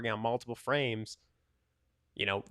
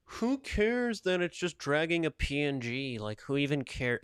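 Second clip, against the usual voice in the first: mocking glazer; 4 semitones higher than usual. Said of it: "the Glazers are like".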